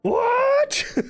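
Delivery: very high-pitched, disbelieving squeal; real astonishment, about to laugh; incredulous. Real, not acted. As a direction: let the voice leap right up in shock. Still stunned by the news.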